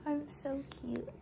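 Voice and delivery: high, sweet cooing voice; high baby voice. Pretend. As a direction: coo it like a baby. Mom voicing her newborn.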